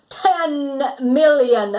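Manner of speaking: hammed-up shouted offer. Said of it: "shouted the archduke".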